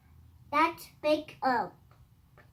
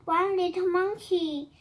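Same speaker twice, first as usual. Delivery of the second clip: ordinary delivery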